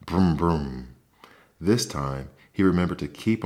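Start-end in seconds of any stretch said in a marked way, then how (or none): 0.1-0.9 engine noise. A narrator's reading. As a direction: rev the toy motorcycle with your voice. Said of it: "Ralph started again".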